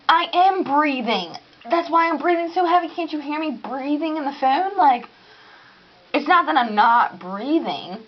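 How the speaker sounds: exasperated mock retort; indignant retort. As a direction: insist you are breathing. Snapping back at operator.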